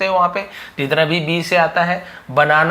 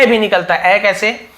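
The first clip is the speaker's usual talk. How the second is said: ordinary talk, nothing marked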